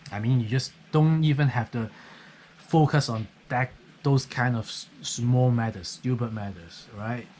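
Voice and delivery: usual speaking voice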